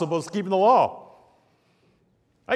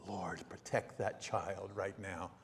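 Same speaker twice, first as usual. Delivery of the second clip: very low, slow murmured prayer; hushed prayer; 10 semitones lower, 19 dB quieter, slower than usual. Retold or imitated. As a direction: pray softly under your breath, reenacted. Retold urgent prayer under his breath.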